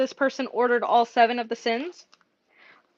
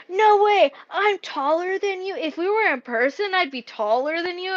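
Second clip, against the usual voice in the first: excited student; 6 semitones higher than usual. Retold or imitated. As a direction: imitate an incredulous student. Voicing students' disbelief about height.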